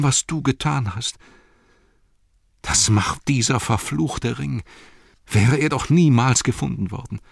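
begging forgiveness from his friend; remorseful plea. Acted.

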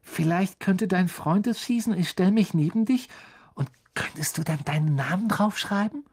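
put-on high, hopeful request from a young fan; pleading young admirer. A narrator's reading. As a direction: ask timidly but eagerly, high-pitched. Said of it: "Er sah Harry flehentlich bittend an".

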